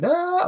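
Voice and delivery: very high imitated yelp of a man clinging on; mock scream. Imitating a shopper clinging to the pallet, high mock yelp. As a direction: squeal it like a man clutching the pallet. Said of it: "He's just screaming".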